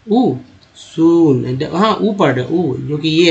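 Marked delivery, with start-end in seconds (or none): 0.1-1.6 सून with a long, slow ऊ; vowel demo. Shown as a demonstration. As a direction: stretch the ऊ in सून. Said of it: "सून को क्या पढ़ रहे हैं?". (सून में ऊ को लंबा खींचना)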